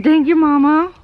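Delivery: high, loud cutesy animal voice; voicing grateful goats. Owner speaks for goats given hay, high cutesy thanks. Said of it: "They said".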